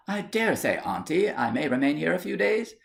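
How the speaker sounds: timid niece. Acted. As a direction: ask your aunt for a bed, a touch hopeful.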